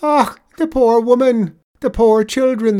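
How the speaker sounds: high, loud wail of pity; sobbing out. A narrator's reading. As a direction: cry out for them, heartbroken and loud. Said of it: "the tradesman sobs out".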